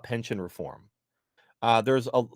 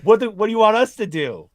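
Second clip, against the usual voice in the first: much higher, louder, mock exasperation; mock exasperated dispatcher; 6 semitones higher, 8 dB louder than usual. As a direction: play the fed-up dispatcher. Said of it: "It's like".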